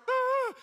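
very high, loud gasp imitating a frightened girl; hammed-up high gasp of fright; scared gasp. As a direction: mimic her terrified gasp. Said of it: "She's so scared".

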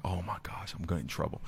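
whispered, worried aside; panicked whisper. Retold or imitated. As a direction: whisper it like you're caught. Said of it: "I was like".

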